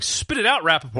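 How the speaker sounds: high, loud impatient jab; impatient heckle. Real, not acted. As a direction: urge him to hurry, exasperated. Impatient at stammering speaker.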